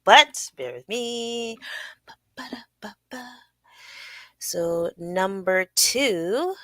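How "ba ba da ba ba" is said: very soft little ba-da noises; idle filler sounds. Pretend. Host asking listeners to bear with them, quiet filler noises. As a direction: absent-mindedly pop out quiet syllables. Fills time while fixing the banner.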